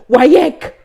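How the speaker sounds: high, loud burst on "wayek"; emphatic exclamation. Retold or imitated. Student stressing it was wayek practical, loud exclamation. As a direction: punch out "wayek" in one high shout. Exclaiming the big exam's name.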